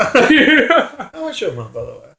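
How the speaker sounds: slow, high, teasing mock politeness; mock polite. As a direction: ask sweetly, slowly, to tease. Teasing about his mum.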